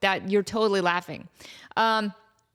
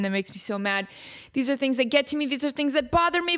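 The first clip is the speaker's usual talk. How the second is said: usual speaking voice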